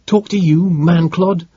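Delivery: loud, high contemptuous retort; spitting scorn. A narrator's reading. Captive boy defies the detective, loud scornful retort. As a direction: spit the insult at him. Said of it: "He spat".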